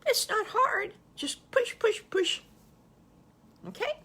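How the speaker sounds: playful coaxing. Real, not acted. Grandma coaxing kids playfully.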